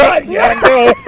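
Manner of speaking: sobbing at the family to leave, hammed-up; imitated sobbing. Imitating a wailing sob, high and loud. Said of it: "I was like".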